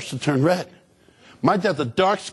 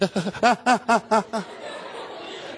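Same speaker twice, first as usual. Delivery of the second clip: high, rapid hiccuping gasps; worsening mock sobs; 4 semitones higher, 4 dB quieter than usual. Shown as a demonstration. Kid failing to stop crying, mock sobbing gasps. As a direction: build stifled sobs into helpless heaving. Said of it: "the worse it gets".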